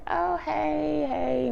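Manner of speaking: high sing-out hello, trailing down; imitating a phony high-pitched hello; mock greeting. Cast greeting Wendy in the room, mock greeting. Mimicking cast's awkward greeting.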